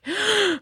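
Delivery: sharp high gasp, like fake crying starting; mock sobbing gasp. Host imitates fake crier with a high gasp. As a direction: gasp as if bursting into tears. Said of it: "seen anyone go from".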